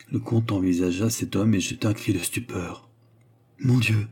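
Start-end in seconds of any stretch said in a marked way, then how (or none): none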